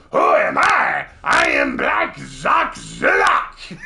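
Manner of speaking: loud, slow, blustering French cartoon villain. Cartoon villain proudly declaring himself after building the dam, bellowing French accent. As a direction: roar it in a hammed-up French accent. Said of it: "and he just goes".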